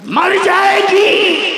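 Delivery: slow, high, shouted punchline; dramatic punch. A narrator's reading. The storyteller lands the twist, slow and shouted. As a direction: drag it out and hit it hard. Narrator's punchline on snake plan.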